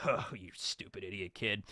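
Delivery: low muttered contempt; Morgan scoffing. Retold or imitated. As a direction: sneer it under your breath. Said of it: "And Morgan's just gonna".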